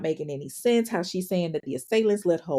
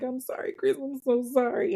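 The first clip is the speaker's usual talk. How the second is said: imitated breaking down; 6 semitones higher than usual. Suspect apologizing to her brother, breaking down. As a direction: act out a small, crumbling apology. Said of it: "Brittany's breaking down".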